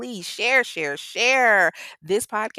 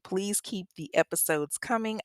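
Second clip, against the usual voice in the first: usual speaking voice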